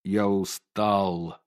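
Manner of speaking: very slow, low, drained; exhausted. Acted. Worn-out painter returns home and says he's tired, drained. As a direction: barely push the words out. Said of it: "замученный".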